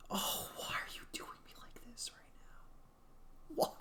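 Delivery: high, breathy whispered whine; high whispered plea; strained whisper. Real, not acted. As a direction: whimper it at the screen. Whining at the game.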